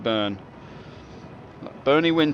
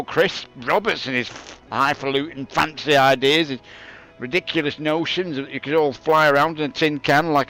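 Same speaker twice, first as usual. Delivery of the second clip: put-on grumpy old-timer; 5 semitones higher, 5 dB louder than usual. Old man grumbling to the kids about the old days, loud and high. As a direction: rant like a cranky granddad who had it harder.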